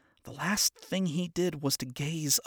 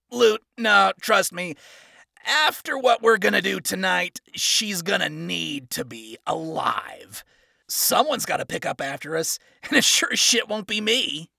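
loud, higher, swaggering; cocky villain; 5 semitones higher, 6 dB louder than usual. Acted. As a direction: boss the lieutenant around. Villain instructs lieutenant.

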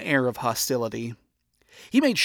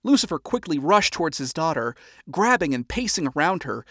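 plain reading voice

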